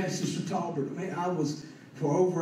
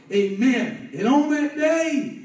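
impassioned preaching; 7 semitones higher, 6 dB louder than usual. Real, not acted. Preacher testifies that God had touched him, impassioned. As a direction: proclaim it to the whole congregation.

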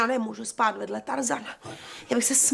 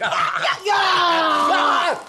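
výkřik; 3 semitones higher, 4 dB louder than usual. Acted. Startled by a spider.

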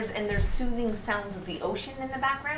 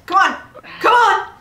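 very loud, high yells; frustrated shouting; 12 semitones higher, 13 dB louder than usual. Real, not acted. Player stuck on the climb, loud frustrated shouts. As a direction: yell it in exasperation.

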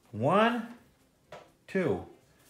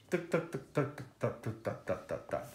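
quiet tuck sound effects; 9 dB quieter than usual. Shown as a demonstration. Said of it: "you have to make the sound effects".

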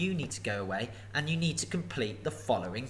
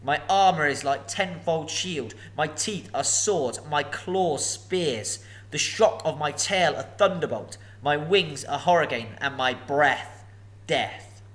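slow, louder boast; boasting dragon; 3 semitones higher, 4 dB louder, slower than usual. Acted. Dragon boasting to Bilbo, slow and grand. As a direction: roll out each boast grandly.

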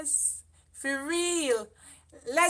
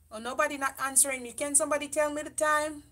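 ordinary delivery

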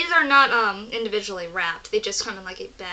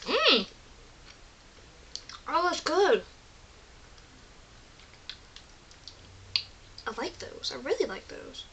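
talking while chewing candy; mouth full, chewing; 4 semitones higher, 6 dB quieter than usual. Real, not acted. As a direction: hand over mouth. Seen 0: Gummy put in mouth at -5; hand at mouth, chewing throughout.

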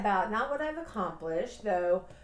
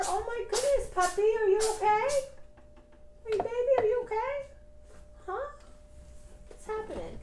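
much higher, sing-song pet talk; 14 semitones higher than usual. Real, not acted. Owner checking on her puppy, in high baby talk. Talking to her startled dog.

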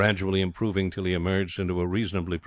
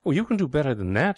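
high, airy Mifflin voice; breezy, higher-pitched character voice; blithe schemer; 7 semitones higher than usual. A narrator's reading. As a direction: cheerfully brush off the complaint.

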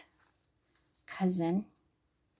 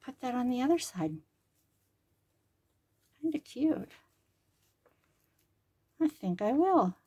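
talking with a pin in her mouth; 4 semitones higher than usual. Real, not acted. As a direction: speak around a pin held in the lips. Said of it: "Talking with a pin in my mouth".

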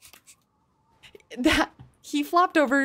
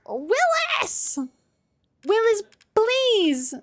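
much higher desperate call; 9 semitones higher, slower than usual. Real, not acted. Gamer calls for an ally to help, high desperate cry. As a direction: shout for help by name.

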